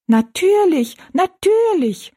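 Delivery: high squeaky little echo, drawn out at the end; echoing little people. A narrator's reading. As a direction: pipe up like tiny people echoing the giant. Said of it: "wiederholten die drei Zwergenmenschen".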